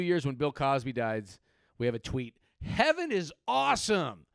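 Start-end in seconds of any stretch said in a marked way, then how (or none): 2.6-4.3 loud, high and drawn out; mock exultation. Dead celebrity tweeting from the afterlife, mock exultant. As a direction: crow it with hammed-up delight.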